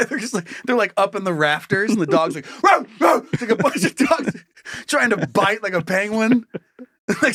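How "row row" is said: loud high barks; mock barking. Dogs barking at workers in the rafters, loud barks. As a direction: bark like a dog.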